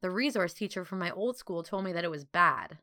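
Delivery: plain reading voice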